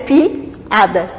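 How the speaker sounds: "piada" broken as "pi... ada"; split hiatus. Shown as a demonstration. Teacher shows hiatus in separate syllables, "piada" split after "pi". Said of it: "vogais em sílabas diferentes".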